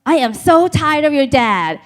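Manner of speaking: fed-up mother whining down the phone; mom complaining. Retold or imitated. Mom on the phone from Thailand asking her to break up with dad, exasperated. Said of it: "She's like".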